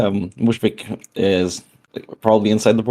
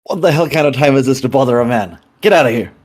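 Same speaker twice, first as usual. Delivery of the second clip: louder, higher annoyed bark; irritated shout; 4 semitones higher, 3 dB louder than usual. Acted. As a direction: snap at the late visitor. Angry man woken at night.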